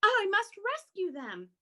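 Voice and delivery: high, urgent little bat voice; urgent resolve. Acted. Stella Luna resolves to rescue.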